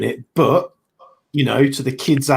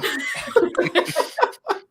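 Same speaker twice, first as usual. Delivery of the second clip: high airy laugh. Real, not acted. Keeps laughing at the story.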